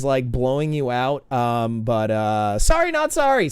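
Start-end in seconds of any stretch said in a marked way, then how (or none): 2.6-3.5 higher, cheeky sorry not sorry; excited cheeky apology; giddy and playful. Real, not acted. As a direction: tease them with an excited grin. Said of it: "my exuberance".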